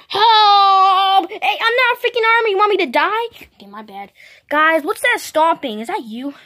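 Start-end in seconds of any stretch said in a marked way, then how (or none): 0.1-1.3 loud, high panicked shout; cry for help. Pretend. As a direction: yell it like you are being stomped. Toy in danger cries out.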